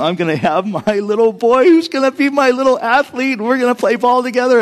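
high, gushing daydream; retold giddy excitement. Dad imagines raising a little athlete, giddy excitement. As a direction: relive a proud dad's eager plans.

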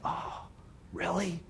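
soft, halting disbelief; tentative wonder with questioning lifts; hushed amazement. Acted. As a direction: barely dare to believe it, very quietly. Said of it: "in his heart it was like".

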